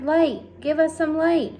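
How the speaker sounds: high loud plea for light; cried out. A narrator's reading. The insects beg the glowworm for light, loud cry. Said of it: "they cried".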